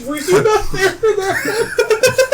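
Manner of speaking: high, loud question breaking into laughter; laughing disbelief. Real, not acted. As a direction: crack up while asking in disbelief. Surprised friend missed the story, laughing.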